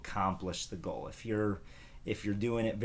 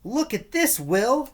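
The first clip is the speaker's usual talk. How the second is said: put-on much higher, eager little kid's call; excited little brother; 8 dB louder than usual. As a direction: cry out like a thrilled small boy. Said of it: "Steve cried".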